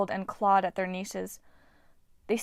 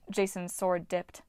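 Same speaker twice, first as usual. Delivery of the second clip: plain reading voice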